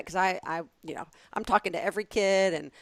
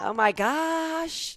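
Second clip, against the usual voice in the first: "Oh my gosh" very slow and much higher; stretched oh my gosh; 11 semitones higher, 3 dB louder, much slower than usual. Shown as a demonstration. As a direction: drag out "Oh my gosh". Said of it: "held the ah out for a while".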